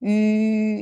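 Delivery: "유" as a long, steady ü; rounded front vowel. Shown as a demonstration. Teacher giving the correct sound, "유" held as ü. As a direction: hold "유" without moving the lips. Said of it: "이렇게 읽으면 정확한 발음이에요".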